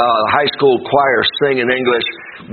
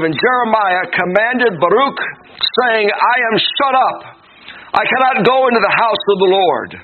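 higher-pitched, declamatory pulpit reading; scripture reading; 9 semitones higher than usual. Acted. Preacher reading Jeremiah's command to Baruch, declamatory scripture reading. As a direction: proclaim the verse to the congregation. Reads scripture aloud to congregation.

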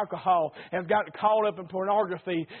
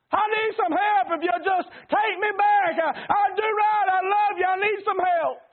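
high, louder desperate plea; crying out for help; 11 semitones higher, 3 dB louder than usual. Retold or imitated. Lost husband crying to his wife for help, desperate. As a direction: beg for help like a lost man.

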